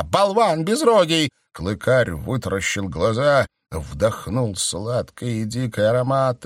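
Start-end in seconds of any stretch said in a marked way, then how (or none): 0.0-1.3 high, loud outburst; furious high shout. A narrator's reading. Stranger scolds the count, shrill furious shout. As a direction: spit the insult up high and loud.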